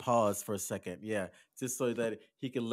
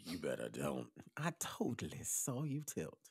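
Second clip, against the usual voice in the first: soft warning, starting very low; hushed catchphrase; 8 semitones lower, 10 dB quieter than usual. Acted. Storyteller quoting the woman's warning, low soft murmur. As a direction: say it softly, opening deep down.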